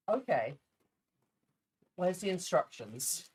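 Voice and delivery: usual speaking voice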